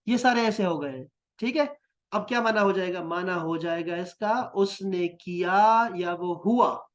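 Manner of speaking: ordinary talk, nothing marked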